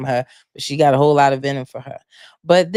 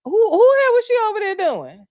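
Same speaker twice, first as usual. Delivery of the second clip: very high, louder mock-shocked question; acted-out incredulous reaction; 4 dB louder than usual. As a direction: act out your shocked reaction in a squeaky high voice. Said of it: "But I was like".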